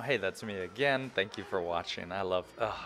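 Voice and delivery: ordinary talk, nothing marked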